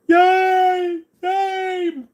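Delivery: very high, loud squeaky yay; squeaky cheer. Pretend. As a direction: cheer like a tiny pet. Milo cheering for the watch.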